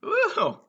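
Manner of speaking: a high, happy whoop on coming back; high woo. Real, not acted. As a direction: whoop like you're back at the party. Returning to the camera.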